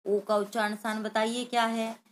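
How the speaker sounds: usual speaking voice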